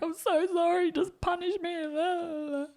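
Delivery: high, drawn-out plea for punishment; pleading child. Retold or imitated. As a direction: beg like a guilty kid. Pleading with disappointed dad.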